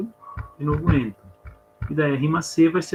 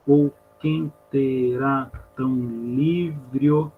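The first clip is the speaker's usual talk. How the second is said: very slow, each syllable of QUEM TERÁ stretched apart; much slower than usual. Shown as a demonstration. Teacher showing students the elision, syllable by syllable. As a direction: drag it out, linking LIVRE O into one syllable. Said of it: "acontece aquilo que chama de elisão".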